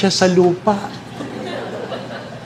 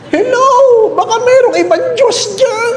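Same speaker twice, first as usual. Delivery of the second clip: high, put-on voice asking if there's another God; comic falsetto giving up on God, half laughing; high mock plea with a laugh. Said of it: "Sabi niya".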